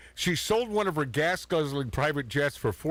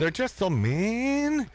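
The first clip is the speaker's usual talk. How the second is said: mock whiny pout; 7 semitones higher, slower than usual. Mocking [name] on exes.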